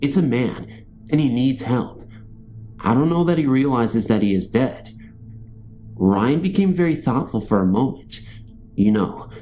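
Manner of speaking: usual speaking voice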